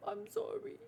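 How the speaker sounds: tiny, high, soft voice; acted meek apology. Girl apologising after learning the truth, meek apology. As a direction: squeak out a hushed apology.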